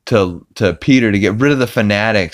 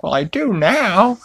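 Chris impression; 14 semitones higher than usual. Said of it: "and he's like".

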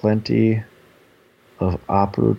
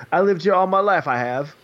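mock British accent, pitched up; 8 semitones higher than usual. Host imitating Statham as an LA cop, in a mock British accent. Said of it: "he's, like, purely British".

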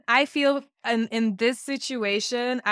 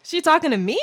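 higher, louder, incredulous question; mock panic; 8 semitones higher, 6 dB louder than usual. Man looking around for help, mock-panicked. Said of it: "he starts panicking".

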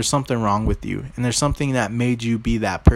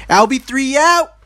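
excited sign-off; 13 semitones higher, 8 dB louder, slower than usual. Real, not acted. Signing off the podcast.

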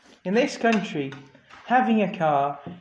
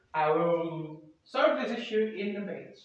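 ordinary talk, nothing marked

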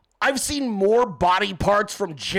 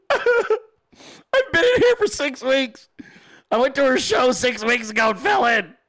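laughing mock plea; 4 semitones higher than usual. Trapped voice continues the bit.

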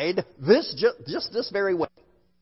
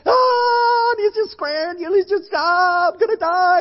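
high, loud mock toddler shriek; hammed-up panicked squeal, held high throughout; imitated screaming; 11 semitones higher, 3 dB louder than usual. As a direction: mimic a terrified little kid screaming his head off. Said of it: "he's just screaming his head off".

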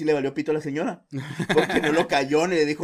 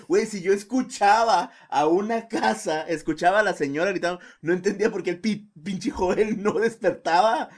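normal talking voice

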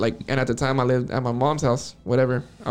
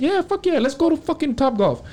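high-pitched, excited imitation of partying friends; eager, high-voiced rallying cry; hyped-up party agreement; 12 semitones higher than usual. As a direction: act out the whole crew getting pumped. Acted excited group while partying.

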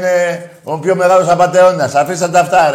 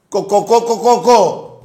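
higher clucking to mock cowards; mock chicken clucking; 6 semitones higher, slower than usual. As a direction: cluck like a hen at them. Mocking callers as chickens. (ειρωνικό κακάρισμα κότας)

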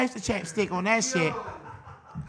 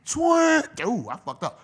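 normal talking voice

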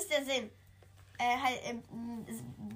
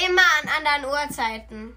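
loud; 11 dB louder, faster than usual. Real, not acted. Answers over loud headphone music.

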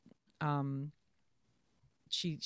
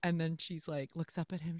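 usual speaking voice

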